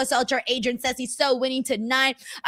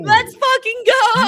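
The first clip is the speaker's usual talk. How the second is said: high, loud, stretched-out rallying cry; hyped shout; 10 semitones higher, 8 dB louder, slower than usual. Real, not acted. Fan hyped for the title match, elated shout. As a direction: yell it like a fired-up fan. Cheering Sting and Darby.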